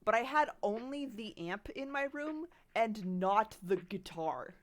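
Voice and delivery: usual speaking voice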